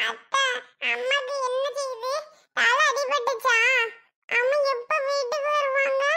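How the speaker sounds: put-on high little-child voice; questioning child. Child asking aunt about injured mother, childlike questioning. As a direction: ask in a small, high child's voice.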